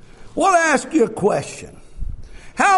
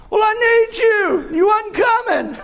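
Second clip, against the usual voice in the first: sick husband's plea; 12 semitones higher than usual. Retold or imitated. Sick husband answers wife.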